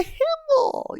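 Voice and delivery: very high squeaky greeting; a goblin squealing a name; squeaky goblin call. Retold or imitated. Goblin greeting a friend, very high squeak. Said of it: "I used all of the squeaky sounds".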